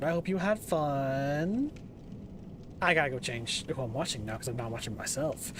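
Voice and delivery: ordinary delivery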